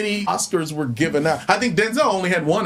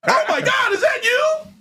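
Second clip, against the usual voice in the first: excited fan; slower than usual. Acted. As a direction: act a starstruck officer meeting a star. Acted fan-and-cop encounter.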